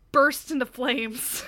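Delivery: loud, drawn-out words cracking into laughter; laughing. Real, not acted. Friend retelling the video's absurd fiery ending, laughing. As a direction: struggle to get it out through laughing.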